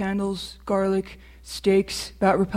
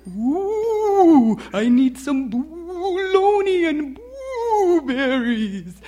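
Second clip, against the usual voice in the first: high slow monster voice; 7 semitones higher, 4 dB louder, much slower than usual. Acted. Child voices a creature customer.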